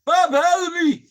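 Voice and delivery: high, loud mock cry for help; acted panic. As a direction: re-enact a panicked plea, rising in volume. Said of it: "I'm hyperventilating".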